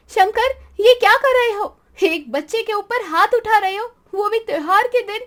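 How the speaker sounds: high, loud outraged shout at the father; angry shout. Acted. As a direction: stop him mid-slap with a shout. Kashvi stops Shankar hitting child.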